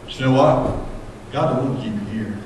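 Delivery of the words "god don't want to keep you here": hushed. Acted. Preacher drops to hush.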